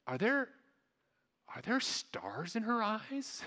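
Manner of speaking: put-on high, slow child's wonder; awestruck little boy. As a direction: imitate a small boy utterly amazed. Imitates awestruck little nephew.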